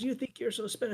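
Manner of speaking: half-hearted mock taunt; mock sneer. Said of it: "he starts screaming and yelling at me".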